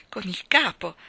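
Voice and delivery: ordinary delivery, nothing marked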